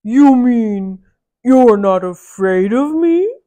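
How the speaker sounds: loud, slow booming giant. Acted. Giant asking Mickey, slow and booming. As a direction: rumble the question slowly like a giant. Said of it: "asked the giant".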